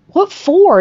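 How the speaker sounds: high, loud, puzzled retort; surprised question. Retold or imitated. Woman questions friend's hundred dollar bill, surprised. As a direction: imitate being taken aback. Acted reaction to offered money.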